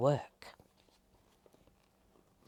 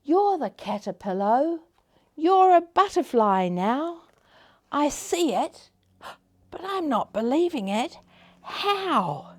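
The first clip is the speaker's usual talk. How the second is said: high, loud disbelief; astonished; 8 semitones higher, 6 dB louder than usual. Acted. Caterpillar seeing the neighbour now a butterfly, astonished. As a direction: exclaim it high, unable to believe it.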